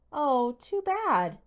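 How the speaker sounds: slow, loud, high mock pity; sarcastic. A narrator's reading. Bully Jake mocking Hal's panic, slow and sarcastic. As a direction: drag it out to twist the knife. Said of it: "Jake said sarcastically".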